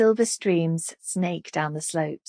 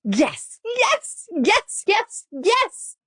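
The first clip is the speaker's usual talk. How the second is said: climbing, much higher yes yes yes; giddy cheering; 3 dB louder, much slower than usual. A narrator's reading. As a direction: burst out in giddy triumph. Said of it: "the anticipation making her giddy".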